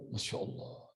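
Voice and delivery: breathless unvoiced gasp of awe; astonished whisper. Bank clerk reading the huge transfer, whispering in awe. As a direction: whisper it in disbelief.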